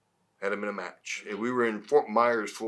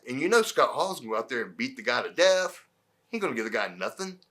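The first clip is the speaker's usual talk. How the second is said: ordinary delivery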